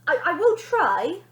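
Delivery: high, loud hesitant promise; gulping mother agreeing; nervous try. Acted. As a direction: stammer it nervously.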